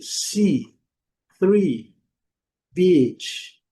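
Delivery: si, three, beach each clearly modeled; teacher's repetition. Shown as a demonstration. Teacher repeating the three words for students. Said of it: "Então, vou repetir".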